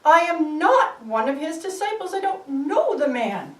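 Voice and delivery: high, loud panicked yelling; terrified shouting. Acted. Peter yells at the men accusing him, frightened and loud. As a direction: Peter shouts his denial in terror. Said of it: "started screaming and shouting and swearing".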